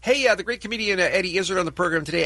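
ordinary talk, nothing marked

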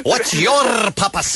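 slow imitation, big rolled R in YOUR; mock the announcer by trilling the R in your; mock trilled R. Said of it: "Why do you trill your R there".

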